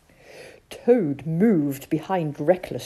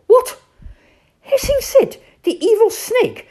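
high, loud indignant cry; outraged shout; 13 dB louder than usual. Acted. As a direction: explode with outrage. Rat's outraged outburst.

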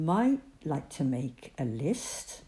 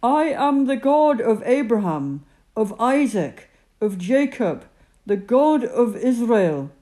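God's voice; 6 semitones higher, 8 dB louder than usual. Acted. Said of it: "the voice replied".

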